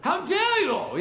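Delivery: put-on high-pitched affronted British accent; John Cleese accent. Pilate to silent Jesus, outraged John Cleese-style British accent. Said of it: "like, like, John Cleese kind of accent".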